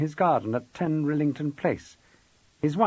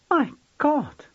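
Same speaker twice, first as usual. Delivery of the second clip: high-pitched, startled gasp of words; startled exclamation; 4 dB louder than usual. A narrator's reading. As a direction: exclaim in shock, pitch jumping up. Startled visitor seeing the murderer portraits.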